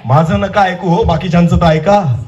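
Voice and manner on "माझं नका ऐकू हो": usual speaking voice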